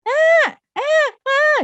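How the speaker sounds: very high and loud ah ah; high-pitched loud demo; high shrill voice. Shown as a demonstration. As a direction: squeal it high up like a nagging mum. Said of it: "gitu ya, tinggi".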